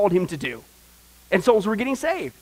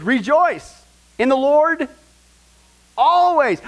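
loud, slow, high proclamation; proclaiming rejoice; 8 semitones higher, 8 dB louder, slower than usual. Shown as a demonstration. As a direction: ring it out like a herald. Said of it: "I'll say it again".

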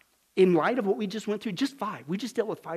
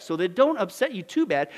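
ordinary talk

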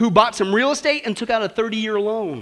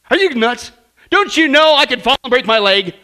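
put-on louder, alarmed boy pushing back; mock-frightened kid; whiny boy refusing skates, raised voice; alarmed refusal; 2 semitones higher, 7 dB louder than usual.

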